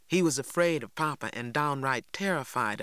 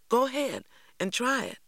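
bright and higher, eager to please; pleased boy urging his friend on; 10 semitones higher than usual. A narrator's reading. A pleased Jeremy urging Stacy to try the flute. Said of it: "said a pleased Jeremy".

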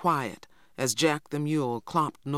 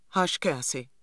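plain reading voice

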